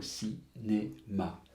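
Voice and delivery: "cinéma" slowly, in three separate syllables. Shown as a demonstration. Teacher rebuilding the word from its syllables, "cinéma" split slowly. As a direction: say "cinéma" as ci, né, ma with gaps.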